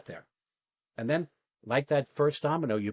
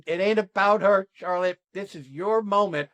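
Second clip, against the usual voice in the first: urgent coaching call; 7 semitones higher, 6 dB louder than usual. Retold or imitated. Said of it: "And I'm like".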